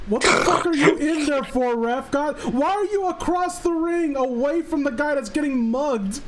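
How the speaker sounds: mock-berate the referee like a fan; outraged at ref. Real, not acted. Fan yelling at the referee in an old fight, outraged.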